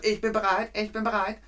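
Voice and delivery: high playful SpongeBob impression. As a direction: squeal it like an eager cartoon sponge. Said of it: "die Stimme von SpongeBob".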